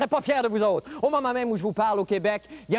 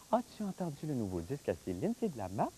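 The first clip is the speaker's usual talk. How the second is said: put-on softer sweet baby-talk delivery; cutesy soft voice; 7 semitones lower, 10 dB quieter, faster than usual. Shown as a demonstration. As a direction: coo it like talking to a kitten. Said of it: "si je le dis avec un minou". (voix mignonne et douce)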